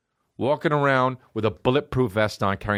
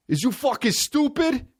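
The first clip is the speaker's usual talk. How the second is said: high-pitched mock rapper outrage; angry rapper impression; 14 semitones higher than usual. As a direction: imitate an indignant rapper, pitched way up. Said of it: "why the fuck are you always so angry".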